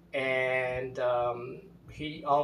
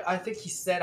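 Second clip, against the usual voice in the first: ordinary delivery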